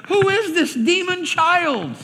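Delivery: high, slow, aghast mother; shocked mother. Retold or imitated. Ralphie's mother horrified at his cursing, high slow gasp. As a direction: recoil in horror at your own child. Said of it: "his mother's like".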